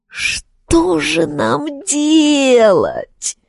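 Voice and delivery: slow, high moaning raven. A narrator's reading. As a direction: groan it out in despair. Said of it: "Калибурн простонал". (стонущий голос ворона)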